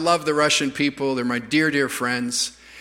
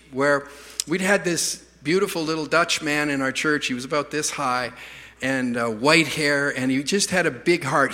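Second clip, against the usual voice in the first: normal talking voice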